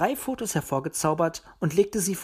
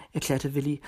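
usual speaking voice

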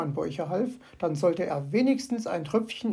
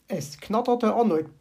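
usual speaking voice

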